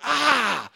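high sharp "ah" of pain; a quick cry as you grab it; pained gasp. Retold or imitated. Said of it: "you're like".

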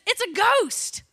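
loud, high mock cry of alarm; hammed-up startled shout, falling at the end; alarmed exclamation. Disciples seeing Jesus walk on water, mock alarmed shout. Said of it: "their first reaction is".

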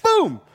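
explosive, high imitated impact; loud punch sound. Preacher imitates his punch on the dog, explosive boom.

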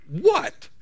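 high-pitched, rising query; high acted "what?"; confronting question. Customer confronts the parrot.

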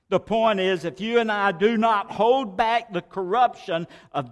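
fiery preacher's climax; preaching at full force. Acted. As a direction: shout it to the congregation.

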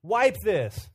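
a hint of a desperate little girl; toddler plea. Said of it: "which means".

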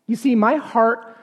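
usual speaking voice

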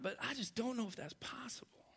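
hushed doubting whisper. Retold or imitated. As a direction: breathe it out in disbelief. Scared prayer to God.

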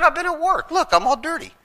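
high, loud, indignant protest; protesting innocence. Retold or imitated. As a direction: insist you're innocent, voice up high. Protesting to the cop.

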